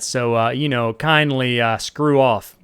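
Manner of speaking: yelling out. Acted. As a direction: holler to the guys below. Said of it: "he yells out".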